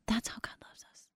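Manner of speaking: hushed whispered conclusion; a tender whispered close to the story. Real, not acted. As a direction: breathe the line as a whisper. Tender point after emotional story.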